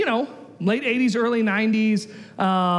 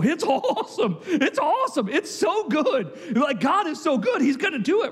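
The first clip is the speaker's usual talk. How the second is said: higher-pitched rave report; mock-eager raving; acted enthusiasm; 7 semitones higher than usual. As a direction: gush like a scout back with good news. Two spies report back with enthusiasm.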